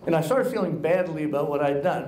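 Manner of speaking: ordinary talk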